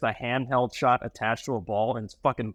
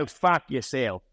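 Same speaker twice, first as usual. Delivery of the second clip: mock Australian accent; slower than usual. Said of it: "I can't do Dave's accent".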